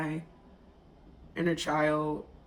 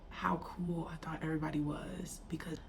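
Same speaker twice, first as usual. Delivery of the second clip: emotional whisper; 9 dB quieter than usual. Real, not acted. Emotional childhood memory.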